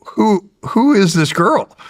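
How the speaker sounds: slow, high, eager questioning of the buddies; drawn-out nosy buddy asking; curious buddies. Retold or imitated. Soldiers asking about his new wife, slow and high.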